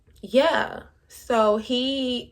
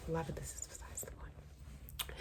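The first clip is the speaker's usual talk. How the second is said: whispered aside, much softer; 5 semitones lower, 26 dB quieter than usual. Real, not acted.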